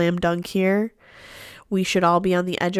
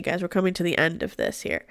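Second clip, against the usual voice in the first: normal talking voice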